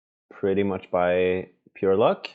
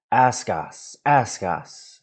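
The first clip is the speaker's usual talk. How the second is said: Gollum; 2 semitones higher, much slower than usual. Acted. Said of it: "said Gollum".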